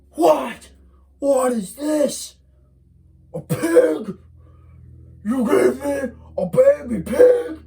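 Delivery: high, loud, slow shout; outraged surprise. Acted. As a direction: bellow in disbelief. Monster finds the pig.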